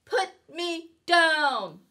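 high, slow, protesting fish; a fish pleading to be let down; upset fish. Acted. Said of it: "said the fish".

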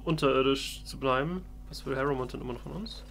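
ordinary talk, nothing marked

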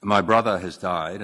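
normal talking voice

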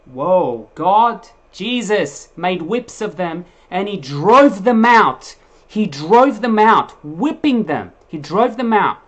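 booming, slow, emphatic preaching; loud preaching. Acted. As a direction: drive it home at full force. Preacher building to loud climax.

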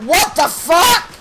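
slow, high, loud yell of disbelief; raging shout. Real, not acted. Gamer yelling after an unfair death, raging shout. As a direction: bellow it like you can't believe it. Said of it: "Hope you enjoyed my little rage".

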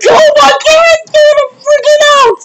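very high, squealing hysteria; freaking out. Real, not acted. Fan seeing the top three, freaking out. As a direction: shriek right at the top of your voice. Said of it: "I'm freaking out".

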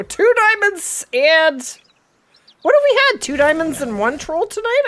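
high, loud counting; excited tally. Real, not acted. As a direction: recount the haul with glee.

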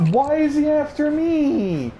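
loud, high, stretched-out protest; distressed high shouting while chased; panicked yell. Real, not acted. Chased by killer in game.

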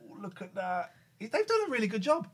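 high soft fast cooing; pet talk. Real, not acted. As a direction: fuss over the cat. Cooing over the cat.

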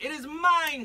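high, loud insistent claim; Lady Pancake demanding, high and loud. Acted. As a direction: demand it outright. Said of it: "she demanded".

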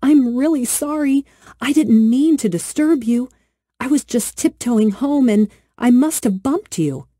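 put-on little girl anxiously begging the magic flower for mercy; pleading little girl. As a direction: beg the flower not to curse you.

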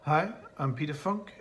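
ordinary talk, nothing marked

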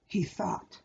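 ordinary delivery

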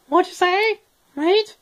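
very high, squeaky challenge; Australian dog. Acted. Dog challenges remark on its accent, very high squeaky voice. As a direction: squeak it like an affronted little dingo. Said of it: "that accent".

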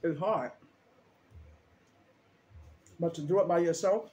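chewing while talking; words muffled by food; mouth full. Real, not acted. Seen 3: Bites sandwich at -2; cheeks full and chewing through the words.

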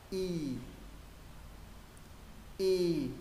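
long ee with lip corners pulled wide; drawn-out, spread-lipped Y. Shown as a demonstration. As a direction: stretch the mouth sideways and hold Y. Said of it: "Chữ thứ hai kéo mép".

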